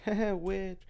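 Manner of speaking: real giggle cutting the word which; laughing. Real, not acted. Breaks into laughter mid-phrase.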